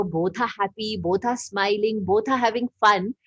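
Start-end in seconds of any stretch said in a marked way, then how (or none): none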